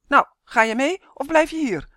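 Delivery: loud, high, exasperated question; impatient shout to hurry up; impatient call. A narrator's reading. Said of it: "riep ze ongeduldig".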